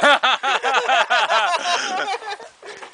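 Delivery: high-pitched peals of laughter; real laughing fit. Real, not acted. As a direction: burst out laughing and keep going. Cracks up over the dog's name.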